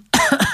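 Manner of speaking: sudden choking cough; choking on a drink. Real, not acted. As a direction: cough from swallowing wrong. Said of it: "habe ich verschluckt".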